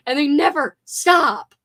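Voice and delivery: loud, high, drawn-out complaint; grumpy exasperation. Real, not acted. Streamer fed up with park music, grumpy exasperation. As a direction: wail it slowly, fed up and grumpy. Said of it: "you sound so old and grumpy".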